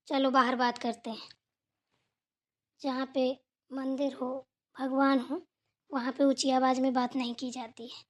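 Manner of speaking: low, soft admonishment; hushed rebuke. Acted. Elder admonishes younger beside the shrine, hushed rebuke. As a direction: keep it low and quiet, pausing between phrases.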